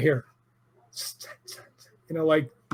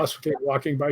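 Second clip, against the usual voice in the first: ordinary delivery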